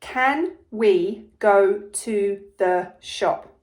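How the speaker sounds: "Can we go to the shop?" said very slowly, word by word; slow dictation. Shown as a demonstration. Teacher to pupils, dictating the sentence very slowly. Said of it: "The sentence is".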